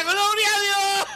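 tearful praise. Acted. Old woman praising God as the earth shakes, tearful. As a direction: cry out praise through tears.

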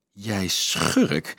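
conspiratorial, merry accusation; delighted, under-the-breath scolding; gleeful hiss. A narrator's reading. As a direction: hiss it with glee. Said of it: "siste Dolf vrolijk".

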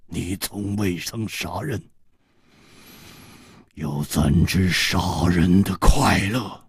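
low, slow, chilling relish; sinister killer. Acted. Killer taunts one who never killed, low sinister relish.

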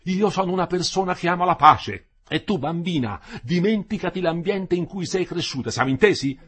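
loud, high, condescending; domineering fiancé lecturing; stern lecturing. Acted. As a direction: talk down to her like a child.

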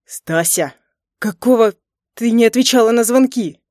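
loud, angry demand; near growl. A narrator's reading. Husband demanding why she ignored his calls, near growl. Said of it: "почти прорычал".

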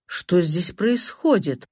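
ordinary delivery

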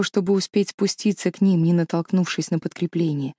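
ordinary delivery, nothing marked

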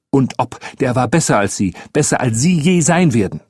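indignant retort, rising in pitch; riled up. A narrator's reading. Boy insisting Billy beat the adult, riled. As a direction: defend your friend hotly, building towards the end. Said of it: "Das brachte Malcolm aber auf die Palme".

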